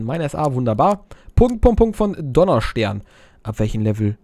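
ordinary delivery

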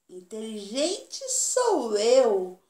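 slow, proud boasting; boastful. Acted. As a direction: puff yourself up and declare it. Donkey boasting to animals. (orgulhoso e lento)

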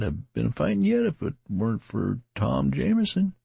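put-on an old country soldier telling his tale; tattered man. A narrator's reading. Tattered man retelling his fight.

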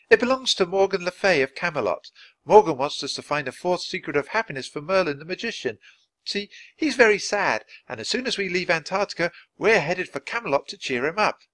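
Annie, high and excited, talking on. A narrator's reading. Annie explaining the tree house to Nancy, excited and high.

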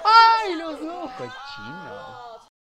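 high, loud laughing squeal; shrieking laugh. Real, not acted. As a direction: cackle and shriek it out. Laughing scream at couple. (chillido entre risas)

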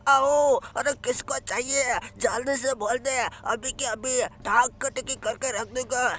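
swagger like a cocky cartoon bruiser; boastful character voice.

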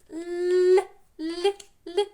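л as a soft palatal sound, high and loud; smiling soft л. Shown as a demonstration. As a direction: sound out soft «ль» through a smile. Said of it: "Знов посміхаємося. М'який". (м'яке високе «л» з усмішкою)

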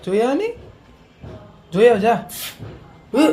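high, coaxing push; playful urging. Acted. Coaxing a little child to go check on auntie, high and playful. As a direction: egg the child on to go look.

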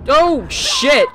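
high startled oh shit; startled shout. Real, not acted. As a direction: yelp in alarm. Sudden monster.

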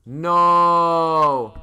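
long, loud held NO; real scream of disbelief, drawn out; anguished shout. Real, not acted. Realises profile switched mid-stream.

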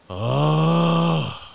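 high, loud drawn-out mock wail; loud held "oh" like a kid; toddler's wail. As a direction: imitate a bratty toddler's long protest cry. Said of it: "She goes".

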